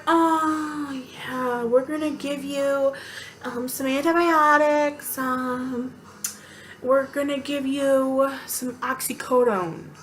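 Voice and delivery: slow, drawn-out, softened put-on voice; hesitant, sluggish imitation of staff; mock hospital staff. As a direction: drag it out like unhurried medical staff.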